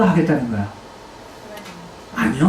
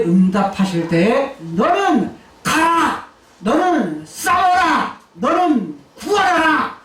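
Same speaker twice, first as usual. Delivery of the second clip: 가라, 싸워라, 구하라를 천천히 힘주어 명령하듯; 한 마디씩 끊어 명령하세요; slow commands; 3 semitones higher, slower than usual. Acted. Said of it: "라고 말하고 있는 거예요". (천천히 단호하게 내리는 명령)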